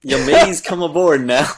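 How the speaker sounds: loud, hammed-up pirate voice; a clumsy pirate voice that falls flat; pirate impression. Shown as a demonstration. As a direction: bellow it like a half-hearted pirate captain. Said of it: "if you could give us your best pirate impression".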